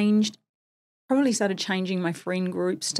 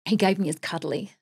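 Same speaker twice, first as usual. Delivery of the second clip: ordinary talk, nothing marked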